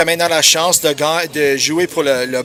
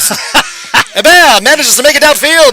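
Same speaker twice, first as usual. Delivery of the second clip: put-on old newsreel voice, higher and stretched out; old-time radio announcer; 5 semitones higher than usual. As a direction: play it like a vintage radio caller. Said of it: "to use that voice".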